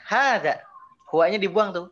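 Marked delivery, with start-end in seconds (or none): 0.0-0.6 "hadza" pitched high and loud, then falling; high, strong "hadza" with a falling tail; emphatic intonation. Shown as a demonstration. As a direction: punch "hadza" and leave it hanging before a pause. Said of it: "kalau pakai intonasi misalnya".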